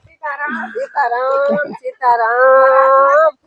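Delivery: high, slow singsong; high lilting call with long final vowels; drawn-out greeting. Real, not acted.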